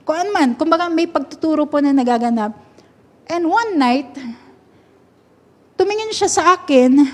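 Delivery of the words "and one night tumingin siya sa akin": normal talking voice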